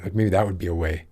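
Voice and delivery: ordinary talk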